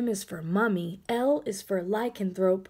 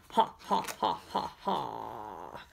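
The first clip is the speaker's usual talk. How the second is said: sinking slow ha ha; evil laugh trailing off; fading spooky laugh; 11 semitones lower, 8 dB quieter than usual. Acted. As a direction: let the laugh drop away low.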